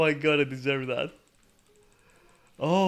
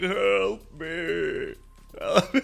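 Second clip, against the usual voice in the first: higher, louder mock plea; imitating the recording; 4 semitones higher, 3 dB louder, much slower than usual. Retelling the Predators bait recording, a mock plea for help. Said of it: "and it goes like".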